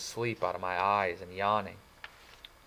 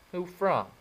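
surprised; 10 semitones higher than usual. Acted. Surprised question.

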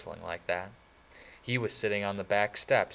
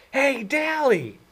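excited call; 10 dB louder, much slower than usual. Acted. Surprised call to a friend.

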